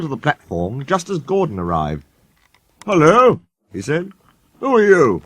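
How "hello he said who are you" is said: haughty engine. A narrator's reading. Sir Handel greeting Gordon.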